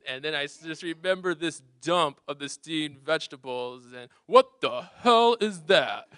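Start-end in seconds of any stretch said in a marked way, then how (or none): 4.3-6.1 dad's outburst. Retold or imitated. Dad reacting to steamed veggies.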